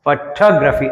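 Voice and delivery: Photography with a weak opening and strong second syllable TOG; stress on second syllable. Shown as a demonstration. Teacher modelling for students, second syllable of photography stressed. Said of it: "అని పలుకుతాం మనం".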